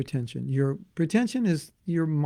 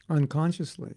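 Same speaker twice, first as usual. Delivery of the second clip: ordinary delivery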